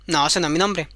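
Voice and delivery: low, smug refusal; the goblin rejecting the queen's guess. Acted. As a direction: answer low and unbothered.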